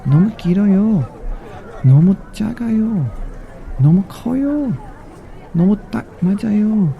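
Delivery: high, quick mouse voice; high quick mouse in Korean; mouse trying hats. Acted. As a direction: squeak each verdict fast.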